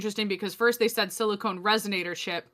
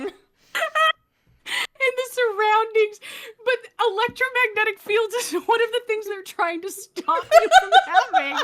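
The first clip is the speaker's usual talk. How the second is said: high, breaking laughter through words; laughing; 11 semitones higher, 4 dB louder than usual. Real, not acted. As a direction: crack up while talking. Laughing at contradiction.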